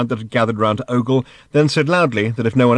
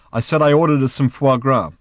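louder, higher, pointed repeat; insistent repetition; 4 semitones higher, 5 dB louder than usual. Acted. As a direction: say it firmly to someone not listening. Repeating to a distracted Arthur.

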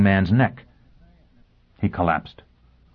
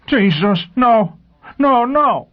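high, loud terrified screaming; hysterical scream; 6 dB louder, much slower than usual. A narrator's reading. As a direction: scream in panic. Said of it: "Forrest began to scream hysterically".

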